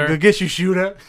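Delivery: lightly voiced Sandler taunt; Happy Gilmore line. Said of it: "do as many Happy Gilmore lines as possible".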